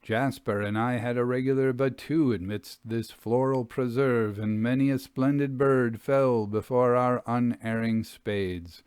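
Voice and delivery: ordinary delivery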